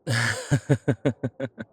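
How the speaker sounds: hearty, rippling chuckle; loud burst of chuckling; amused laugh. Acted. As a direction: laugh out loud, entertained by your guest. Entertained by his guest.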